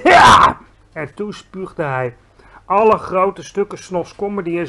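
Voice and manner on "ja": loud high roar; disgusted roar. A narrator's reading. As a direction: spit it out with a roar. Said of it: "brulde de bloedbottelaar". (luid gebrul)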